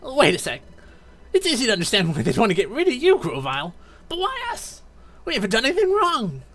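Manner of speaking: protesting innocence, voice up high; anxious young hero. Acted.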